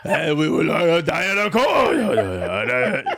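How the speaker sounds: a bad put-on Chinese accent, laughing; slow halting mock Chinese accent ordering food. Mocking the uncle ordering at a Chinese restaurant, bad Chinese accent. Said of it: "he will just do a Chinese accent".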